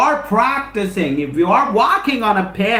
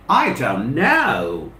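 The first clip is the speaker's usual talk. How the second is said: slow, lower shrugging i don't know; drawn-out i don't know; 7 semitones lower, slower than usual. Acted. Asking students how long, then answering slowly and lower with a shrug. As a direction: let the answer hang, unsure. Rhetorical question answered by himself.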